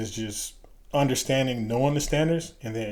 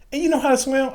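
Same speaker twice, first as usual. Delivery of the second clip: put-on very high, incredulous Southern-accented question; mock Southern instructor, astonished and loud; strong Southern accent; 7 dB louder than usual. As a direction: ask in Southern-voiced disbelief, pitch shooting up. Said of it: "strong Southern accent or whatever".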